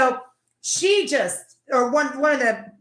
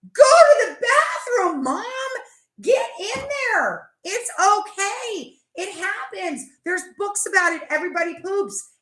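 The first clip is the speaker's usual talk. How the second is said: laughing shout; 7 semitones higher, 4 dB louder than usual. Acted. Yelling at mom in video.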